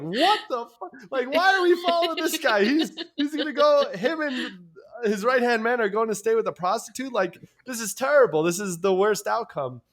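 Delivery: high, loud, incredulous monks; hammed-up scandalized disbelief; mock outrage. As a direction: protest loudly as an appalled follower. Acted outrage of the other monks.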